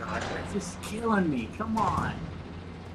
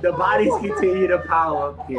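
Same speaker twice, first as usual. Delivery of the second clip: giggly, hammed-up Russian accent; 4 semitones higher, 3 dB louder than usual. Player reading a game note on request, mock Russian accent. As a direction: put on a Russian accent while cracking up.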